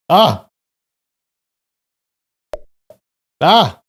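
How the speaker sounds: short loud high frustrated yell; frustrated yell at the quiz screen. Real, not acted. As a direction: let out a sharp cry of annoyance. Misses a prefecture.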